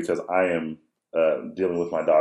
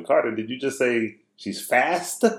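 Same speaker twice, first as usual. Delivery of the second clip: slow, higher question leaning on "fast"; leading question; 5 semitones higher, slower than usual. Retold or imitated. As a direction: stretch "fast" as a hopeful correction. Dad offering son a way out.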